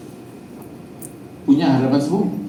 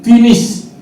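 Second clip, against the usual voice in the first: loud, high burst as God's verdict; acted emphatic declaration; 9 semitones higher, 9 dB louder than usual. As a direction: punch it out as a final word. Menirukan Tuhan memutuskan dengan tegas. (menirukan seruan tegas yang lantang)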